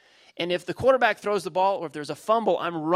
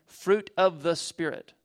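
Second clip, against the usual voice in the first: "Fruit of the Spirit" drawn out slowly, word by word; slow emphatic repeat; slower than usual. Shown as a demonstration. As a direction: let it sink in, slowly.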